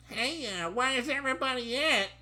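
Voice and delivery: very high, timid character voice; mock trembling voice. Trainee asking his trainer, hammed-up trembling voice. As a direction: ask nervously in a squeaky voice. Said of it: "I asked in a trembling voice".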